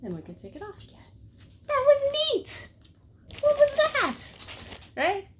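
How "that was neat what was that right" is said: much higher, slow singsong praise; excited dog praise. Pretend. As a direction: cheer the dog in a high singsong.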